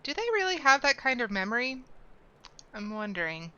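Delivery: ordinary talk, nothing marked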